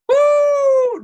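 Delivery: high, loud whoop of excitement; excited whoop. Real, not acted. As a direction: let out a joyful shout. Preacher's excited outburst.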